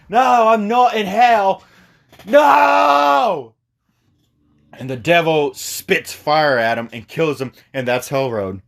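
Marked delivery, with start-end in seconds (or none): 0.1-3.6 higher, louder, building to a yelled No; villain refusing his fate, crying out; desperate shout. Retold or imitated. Doomed villain protesting to the devil, rising shout. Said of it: "he's like".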